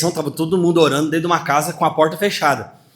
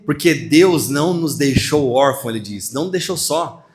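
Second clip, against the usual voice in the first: usual speaking voice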